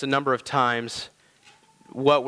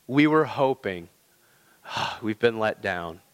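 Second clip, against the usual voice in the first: mild retold lament; 5 semitones lower than usual. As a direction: imitate a let-down follower, sighing midway. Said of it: "they're kinda lamenting to Jesus".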